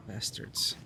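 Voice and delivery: whispered curse under breath; muttered curse. Real, not acted. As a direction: hiss it out quietly. Scared streamer cursing under breath.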